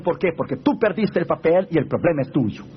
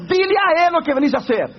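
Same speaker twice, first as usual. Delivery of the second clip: final command; 11 semitones higher than usual. Retold or imitated. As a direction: give the last firm order. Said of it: "Dios le dijo por la última vez".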